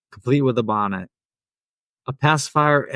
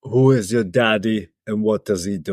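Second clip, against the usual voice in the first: lower-voiced Austrian accent impression; Arnold Schwarzenegger; 4 semitones lower than usual. Shown as a demonstration. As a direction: drop down into an Austrian strongman voice. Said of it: "is Arnold Schwarzenegger".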